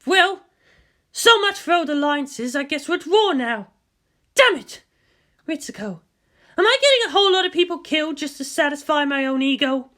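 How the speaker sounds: lightly voiced determined commander, urgent; a grim officer pushing through pain; Misato, tense. Narrator voicing the wounded commander to the leaders, urgent. Misato bitter after the call.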